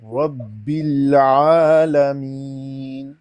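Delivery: drawn-out tajweed recitation for the student to copy; slow Quran recitation. Shown as a demonstration. Teacher recites slowly for the student to repeat. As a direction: recite slowly, stretching each vowel. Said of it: "اچھا جی، شروع کرتے ہیں".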